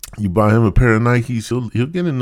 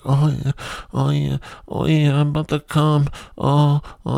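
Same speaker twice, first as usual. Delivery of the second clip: higher, breathy-free hammed-up moans; mock moaning; 6 semitones higher than usual.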